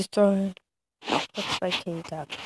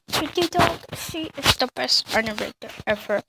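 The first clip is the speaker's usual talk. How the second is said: excited; 5 semitones higher, 5 dB louder than usual. Real, not acted. Excited intro of favorite animator.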